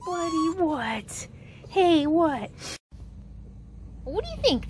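soft puppy talk. Real, not acted. Owner softly coaxing her new puppy in tender baby talk. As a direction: murmur sweetly to a tiny puppy.

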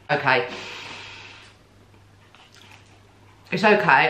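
talking while chewing; speaking between bites; mouth full. Real, not acted. Seen 1: Bites sandwich at -1.0, chews with closed lips until about 3.8.

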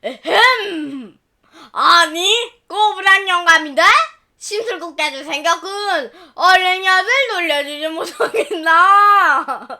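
put-on loud gruff old man's scolding; stern grandpa. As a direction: clear throat, then scold.

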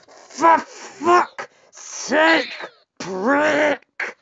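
very high, drawn-out angry wail; swearing tirade. Real, not acted. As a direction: stretch out the curse in fury. Raging at the game.

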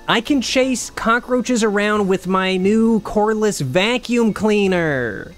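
gleeful about the new vacuum; giddy delight. Real, not acted.

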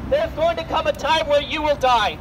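usual speaking voice

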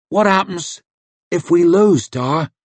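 put-on high boy's voice; a small worried boy asking; child's voice. The son asks his father about losing, high boy's voice.

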